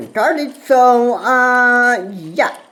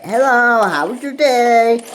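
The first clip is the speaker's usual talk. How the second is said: imitated chatty greeting, higher and drawn out; small-talk greeting; 6 semitones higher, 4 dB louder than usual. Man mimicking how online buddies open a conversation, mock small talk. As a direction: put on a slow, loud small-talk hello. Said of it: "start with general conversations like".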